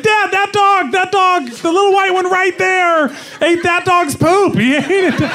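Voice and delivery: put-on high excited child tattling; daughter voice. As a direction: snitch on the dog like an excited kid. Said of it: "She's like".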